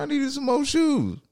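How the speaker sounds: high-pitched retold excuse; mock protest. Son defending his purchase to dad, high mock protest. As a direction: plead it in a comic high voice. Acts out own reply to dad.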